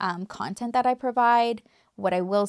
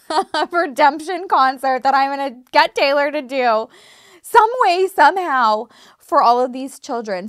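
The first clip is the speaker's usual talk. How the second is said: high, loud, laughing burst of excitement; real giddy excitement, much louder and higher; 5 semitones higher, 8 dB louder than usual. Real, not acted. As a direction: gush about the dream concert through laughter. Dreaming up a redemption concert.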